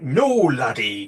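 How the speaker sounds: put-on slow, half-hearted Scottish accent; a weak stab at a Scottish accent, drawn out. Quoting Neil's answer in a mock Scottish accent. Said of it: "I can't do the Scottish accent".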